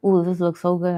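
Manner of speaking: ordinary talk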